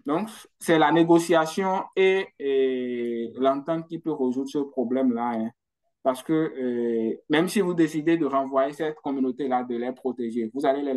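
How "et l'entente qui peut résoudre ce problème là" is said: ordinary talk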